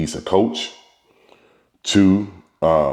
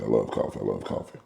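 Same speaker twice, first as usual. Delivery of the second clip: each repeat softer, trailing off to a murmur; fading murmur; 9 semitones lower, 10 dB quieter, faster than usual. Pretend. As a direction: let it fade away like a drifting thought. Playful aside, voice fading away.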